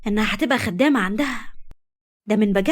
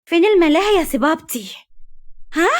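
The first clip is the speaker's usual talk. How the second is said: put-on girl angrily reproaches her father about the amusement park; angry child; 9 semitones higher than usual. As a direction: protest like a cheated child, stressing اصلًا. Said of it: "حبيبة بغضب".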